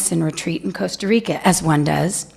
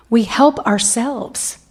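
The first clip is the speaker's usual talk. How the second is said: usual speaking voice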